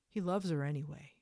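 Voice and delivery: normal talking voice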